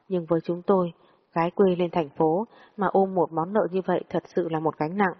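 ordinary delivery, nothing marked

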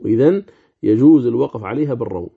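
ordinary talk, nothing marked

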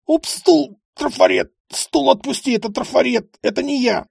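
high, loud, strained cries of pain; panicked thug yelling. Acted. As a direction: yelp like a cornered thug being hurt. Бандит орёт под пыткой. (высокий панический крик бандита)

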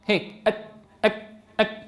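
soft أك with no breath release; dull kaf. Retold or imitated. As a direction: show the breathless wrong kaf. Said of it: "هكذا ينطق الكاف".

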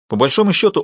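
ordinary delivery, nothing marked

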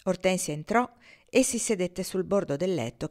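plain reading voice

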